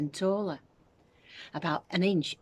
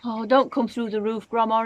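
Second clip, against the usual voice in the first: higher, louder anxious plea; George praying; 6 semitones higher, 6 dB louder than usual. A narrator's reading. George prays Grandma stops, anxious and pleading. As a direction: pray it under pressure. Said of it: "he prayed".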